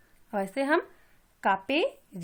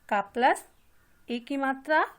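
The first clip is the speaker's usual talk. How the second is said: ordinary talk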